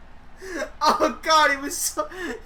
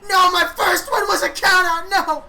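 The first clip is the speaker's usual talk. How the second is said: higher, louder whiny protest; mock protest; 7 semitones higher, 5 dB louder than usual. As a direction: refuse the count-out win indignantly. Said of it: "He's like".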